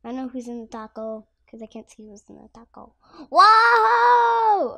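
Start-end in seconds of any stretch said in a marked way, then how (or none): 3.3-4.7 loud high whoa on the ride; excited whoa. Real, not acted. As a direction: yell it with thrill. Thrilling ride down the slide.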